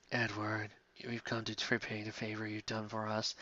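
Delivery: soft ghostly whispered call; eerie whisper. Acted. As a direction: call him like a spirit from beyond. Ghost voices speak through her.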